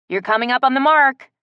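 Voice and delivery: loud call upward. A narrator's reading. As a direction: call it up the tree. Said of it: "called up to her".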